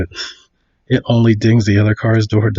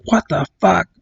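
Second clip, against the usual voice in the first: mock outrage, pitched higher; retold angry outburst; 6 semitones higher than usual. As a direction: act out an angry driver's disbelief. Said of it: "he's getting all mad".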